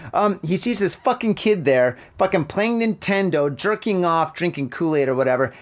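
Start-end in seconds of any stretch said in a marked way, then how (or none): none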